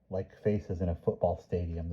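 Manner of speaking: ordinary delivery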